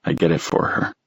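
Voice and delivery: usual speaking voice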